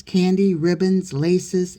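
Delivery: ordinary delivery, nothing marked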